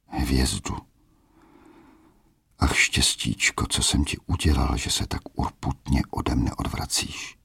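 quiet, sinking lament, fading to a whisper; despairing lament. Acted. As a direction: mourn his bad luck under his breath. Ondřej laments alone after leaving.

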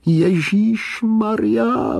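high, long-drawn exclamation; drawn-out high cry of rapture. A narrator's reading. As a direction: cry it out high, stretching every vowel. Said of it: "vykřikl".

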